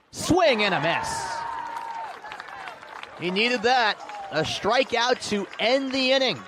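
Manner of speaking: loud, high burst of joy; excited strikeout call. Real, not acted. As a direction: shout it as the final out lands. Strikeout ends the inning.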